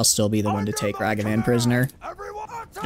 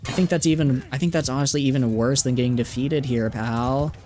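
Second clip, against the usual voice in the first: mocking Valley Girl. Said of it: "say this in a Valley Girl accent".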